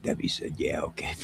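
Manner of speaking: unvoiced whispered aside; conspiratorial whisper. Real, not acted. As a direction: whisper it behind her back. Whispered aside about Debbie.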